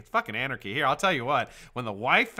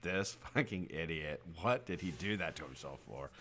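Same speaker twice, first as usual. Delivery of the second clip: inner thought; 6 semitones lower, 11 dB quieter than usual. Retold or imitated. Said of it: "I'm like".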